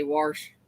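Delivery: "wash" with an added r, like "warsh"; hillbilly pronunciation. Shown as a demonstration. Showing our hillbilly talk, "wash" pronounced "warsh". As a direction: put a country r into "wash". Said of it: "our hillbilly talk where we say".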